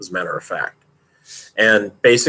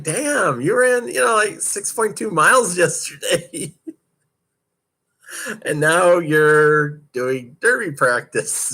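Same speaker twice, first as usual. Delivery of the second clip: high, astonished disbelief at a teammate; high, laughing mock amazement; amazed disbelief; 7 semitones higher, slower than usual. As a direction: marvel that she ran the day before. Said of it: "I was like".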